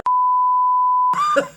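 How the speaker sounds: a sudden high, loud burst of laughter. Real, not acted. Laughing at his own changed voice, a loud high burst of laughter. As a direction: crack up at yourself.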